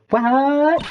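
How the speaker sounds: high, stretched cry of disbelief; drawn-out high yelp; shocked. Acted. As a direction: react in stunned alarm. Shocked at murder news.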